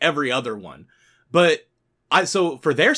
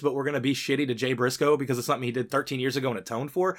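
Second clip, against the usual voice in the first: ordinary talk, nothing marked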